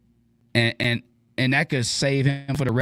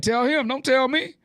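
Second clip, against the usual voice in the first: very high, rising playful deflection; light singsong brush-off; non-aggressive comeback; 13 semitones higher than usual. Shown as a demonstration. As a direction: toss it back high-pitched, without aggression. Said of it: "I'll say it like that".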